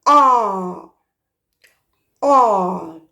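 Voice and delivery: "ah" wide open like at the dentist. Shown as a demonstration. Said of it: "You go".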